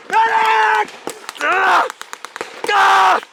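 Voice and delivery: man down shout. Acted. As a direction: scream for help, drawn out. Wounded soldier calling for help.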